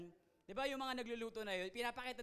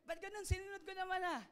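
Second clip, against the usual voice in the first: baffled cook; 12 semitones higher, 3 dB louder than usual. Retold or imitated.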